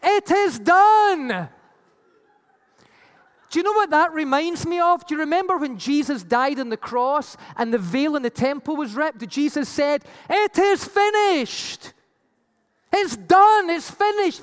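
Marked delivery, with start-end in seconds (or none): 0.0-1.5 slow, loud proclamation, higher; loud temple voice. Retold or imitated. Preacher voicing the voice from the temple, slow loud proclamation. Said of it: "A loud voice from the temple saying". 10.3-14.4 slow, raised cry, higher and louder; Jesus on the cross. Retold or imitated. Preacher voicing Jesus on the cross, slow loud higher cry.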